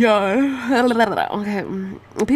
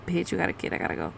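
imitating a match in a much lower, softer voice; mock dismissive guy; 4 semitones lower, 11 dB quieter, much faster than usual. Retelling a match who drops her, lightly voiced low and soft. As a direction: drop low and quiet like a guy bailing out. Guy ghosting after hearing of kid.

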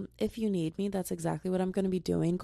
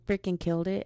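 ordinary talk

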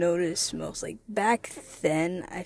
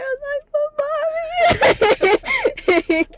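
a hint of a crying child calling mama, much higher; mock crying call; 11 dB louder than usual. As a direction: whimper it like a small kid. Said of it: "I was just crying".

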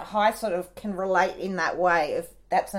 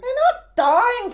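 much higher, louder, slow protest; exasperated; 6 dB louder, slower than usual. Real, not acted. Friend exasperated by the crying story, higher and louder. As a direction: scold them for overreacting.

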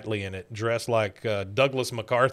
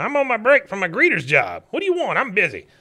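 high, loud cartoon grouch; irritated old father; 8 semitones higher, 6 dB louder, faster than usual. Father fending off his son from behind the door, mock irritated. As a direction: put on a much higher voice and brush him off. Imitated father snaps from toilet stall.

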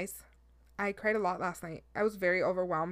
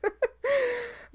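a short, high laugh. Real, not acted. After ranting about voice messages, a high laugh. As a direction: burst into a high giggle. Laughs at her own rant.

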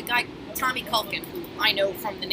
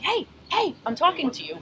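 high sharp re-enacted yell; shout; 8 semitones higher, 3 dB louder than usual. Bartender re-enacts yelling at the Marine, high shout. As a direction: shout it again as if in his face. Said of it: "And I'm just like".

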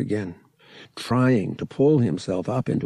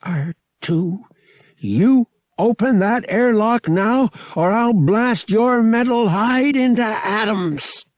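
much higher, louder, slow menace; threat; 12 semitones higher, 6 dB louder, slower than usual. A narrator's reading. Pilot aiming blaster at droid, slow loud threat.

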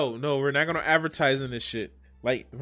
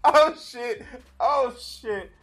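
laughing; 9 semitones higher, 4 dB louder, much slower than usual. Real, not acted. Laughing at clip's burn joke.

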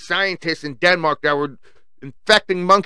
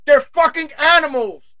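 outraged outburst; 10 semitones higher, 4 dB louder than usual. Real, not acted. Outraged at WHO renaming.

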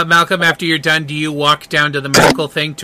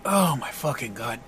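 much softer, lower aside; muttered exasperation; 5 semitones lower, 13 dB quieter than usual. Acted. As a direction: mutter it under your breath in disbelief. Acting a muttered reaction.

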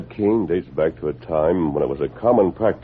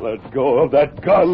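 shouting order; 6 semitones higher, 3 dB louder, slower than usual. Acted. Hero grapples with crook.

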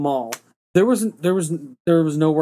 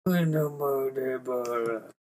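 trailing off; 6 semitones lower, 9 dB quieter, much slower than usual. Retold or imitated. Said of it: "you're just trailing off again".

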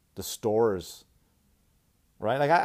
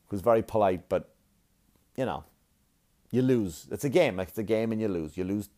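ordinary talk

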